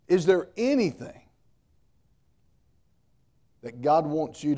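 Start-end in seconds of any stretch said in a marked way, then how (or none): none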